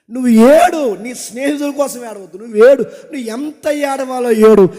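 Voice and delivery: loud pulpit exhortation with punched commands; full-force preaching. Acted. Preacher urging the men of the church, loud and fervent.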